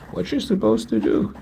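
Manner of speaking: stunned high question; incredulous. Real, not acted. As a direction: squeak it out in disbelief. Wondering about cannibalism.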